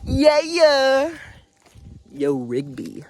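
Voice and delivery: a hammed-up, high Regular Show voice; a badly faked cartoon character; bad cartoon impression. Fan doing a weak Regular Show cartoon impression. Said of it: "that was a really bad impression".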